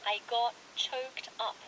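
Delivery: slow, clear model phrase; slow recap. Shown as a demonstration. Host recapping the phrase slowly for learners. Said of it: "울컥했다".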